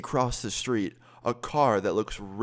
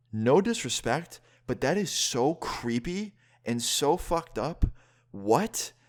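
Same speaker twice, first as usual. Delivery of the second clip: slow, higher-pitched disbelief; incredulous; 5 semitones higher, slower than usual. Retold or imitated. Telling her she is so creepy, incredulous. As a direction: spell out the disbelief slowly, pitch climbing. Retold confrontation over being stalked.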